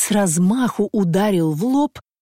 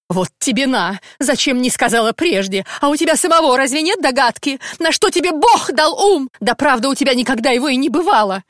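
higher, louder nagging; angry scolding; 7 semitones higher, 3 dB louder than usual. Acted. Wife scolds husband for not knocking, high angry nag. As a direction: berate him like a shrew.

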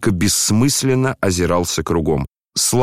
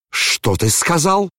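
slow, high-pitched outraged question; thundering challenge; 9 semitones higher, slower than usual. A narrator's reading. General stepping toward his insolent son, thundering challenge. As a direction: challenge him high, stretching each word. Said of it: "загремел генерал".

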